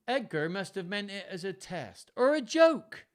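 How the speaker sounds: high, loud character voice; Edmund's suggestion. A narrator's reading. Son suggests an excuse to father, high innocent voice.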